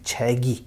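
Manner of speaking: छैगी said joined, with a voiced g; correct carry-over pronunciation. Shown as a demonstration. Teacher telling learners to pronounce it like this, correct carry-over pronunciation. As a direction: let the final consonant slide over: छैगी as chhe-gi. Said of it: "इसको ऐसे pronounce करेंगे".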